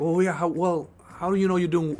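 imitating a friendly stranger's question; curious questioner. At the conference, a guy asks how he's doing, lightly voiced. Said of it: "then he's like".

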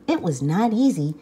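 plain reading voice

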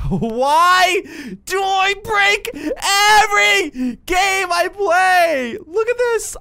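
high, loud, slow laughing cry of despair; laughing wail. Real, not acted. Stuck in the game again, a real laughing high wail. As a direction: wail it through laughter, exasperated.

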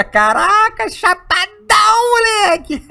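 high laughing shout. Real, not acted. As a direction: crack up, almost crying with laughter. Laughing at stoned character. (gargalhando alto)

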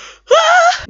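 high rising startled gasp; loud sharp gasp. Real, not acted. Gamer gasps loudly at something on screen.

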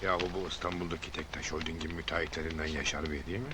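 muttered under the breath; low, hushed aside; quiet aside. Acted. Muttering aside on seeing rival.

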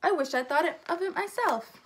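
high-pitched storybook enthusiasm; lightly acted, excitable admiration; excited shriek. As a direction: keep it high and eager. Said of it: "shrieked Nebraska".

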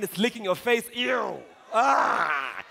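loud, high comic revulsion; mock disgust. Storyteller reacting to a dog licking faces, mock disgust. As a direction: recoil with a hammed-up ew and cry.

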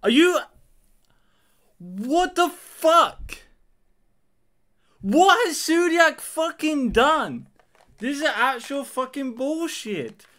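high-pitched, incredulous outrage; really pissed off. Real, not acted. Player raging at the new ban list, pissed off. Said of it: "I'm actually fucking pissed".

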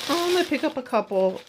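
high, light voice for her own past thought; bright little sing-song of shopping intent; casual quote. Retold or imitated. Shopper quoting her own thought, high and light. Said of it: "I just was like".

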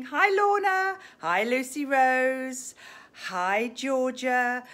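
high, loud sing-song hellos; loud cheerful hi; cheery greeting. Real, not acted. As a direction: call out hellos to viewers. Greeting viewers on live.

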